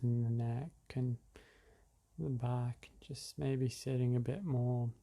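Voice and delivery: guided meditation. Acted. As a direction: ease listeners into the breath gently.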